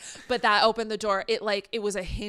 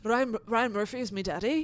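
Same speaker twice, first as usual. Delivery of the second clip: bad Irish accent. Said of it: "I did it bad".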